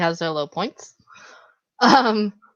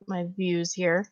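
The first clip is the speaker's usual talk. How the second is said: ordinary talk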